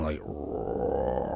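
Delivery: low, soft growling roar; a quick low growl, half-hearted; mock dog growl. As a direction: imitate a big dog growling under the table. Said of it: "sound like a big ass dog under the table growling".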